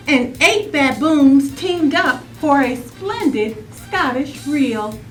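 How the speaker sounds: plain reading voice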